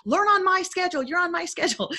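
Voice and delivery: high, rushed mock impatience. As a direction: act out hurrying the kids along. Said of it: "my voice elevates".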